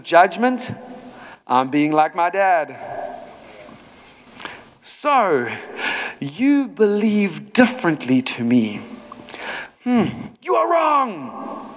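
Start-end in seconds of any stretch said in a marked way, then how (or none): 4.8-11.7 sneering mock rebuke, Hmm drawn high and slow; mock judgmental. Preacher mocks a judgmental believer, sneering. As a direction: ham up a judgy churchgoer.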